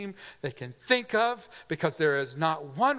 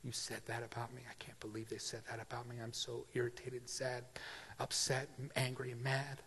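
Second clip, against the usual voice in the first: sulky grumble; 3 semitones lower, 13 dB quieter than usual. Retold or imitated. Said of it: "you wanna hold it against somebody".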